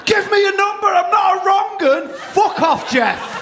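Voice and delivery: high, strained pub shout; shouting over loud music. Acted. Man to a woman in a pub, shouting over Wonderwall. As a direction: yell it up high over a blaring song. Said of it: "shout at her face while Wonderwall's on loud".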